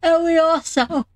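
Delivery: words stretched through a big yawn. Real, not acted. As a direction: talk through a yawn, pitch jumping up. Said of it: "pardon me for the yawn".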